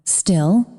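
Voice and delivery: usual speaking voice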